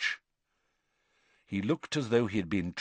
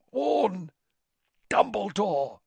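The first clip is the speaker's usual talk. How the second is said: strained effort; 10 semitones higher, slower than usual. Acted. Crouch straining, high.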